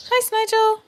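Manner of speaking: high cooing "Hi, Snigel.". Real, not acted. Baby talk to the pet.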